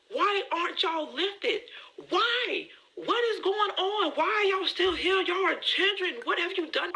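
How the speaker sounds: put-on high, panicked pleading at her children; freaking out. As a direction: demand answers in a panic. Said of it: "I'm just freaking out".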